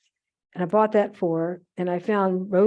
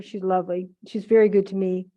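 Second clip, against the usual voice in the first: ordinary talk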